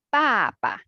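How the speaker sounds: "Ba" then a shorter, lighter second "ba"; second "ba" barely touched; neutral tone again. Shown as a demonstration. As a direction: repeat "Ba ba" with a brief neutral second syllable. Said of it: "One more time".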